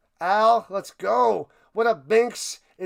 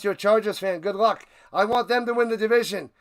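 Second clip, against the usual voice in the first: ordinary talk, nothing marked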